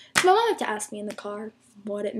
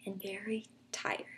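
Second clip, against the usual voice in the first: tired whisper; 3 semitones lower, 13 dB quieter than usual. Retold or imitated. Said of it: "She's like".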